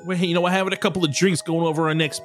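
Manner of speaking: chatty little gnome. Acted.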